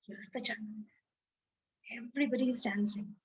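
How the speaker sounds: soft, quick, hushed words; hushed aside. A narrator's reading. Storyteller pointing out the jungle, hushed and quick. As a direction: whisper it fast like a secret.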